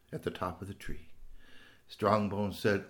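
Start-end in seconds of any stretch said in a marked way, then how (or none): none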